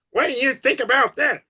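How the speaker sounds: high put-on voice of a curious person; imitating a questioner. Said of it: "somebody comes up to you and goes".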